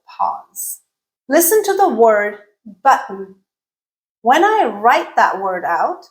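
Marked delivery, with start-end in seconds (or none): 2.8-3.3 "button" with a glottal stop for the T. Shown as a demonstration. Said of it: "I am definitely not saying the T sound".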